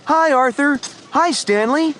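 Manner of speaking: put-on high-pitched boy's friendly call. A narrator's reading. Friend greeting Arthur and Stanley at skating, calling out. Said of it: "called their friend Martin Tibbs".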